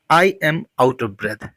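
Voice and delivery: very slow, deliberate model sentence. Shown as a demonstration. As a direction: drag every word out for learners. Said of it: "इस condition को कहते हैं out of breath". (बहुत धीमे, साफ़ बोला गया वाक्य)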